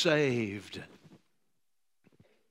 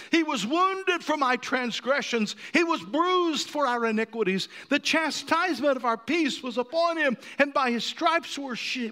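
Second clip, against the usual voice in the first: loud, high preaching read; Isaiah read at full force; preached scripture; 13 semitones higher, 6 dB louder than usual. Acted. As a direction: proclaim the verse.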